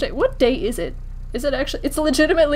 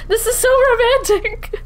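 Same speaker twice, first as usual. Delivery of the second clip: mock swoon; 12 semitones higher, 5 dB louder than usual. Mock swooning at game romance.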